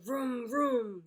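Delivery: ordinary delivery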